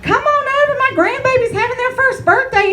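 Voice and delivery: high, sweet mock invitation; mock grandma invite. Comic mimicking a grandma's party invite. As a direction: squeak out a sugary grandma invite.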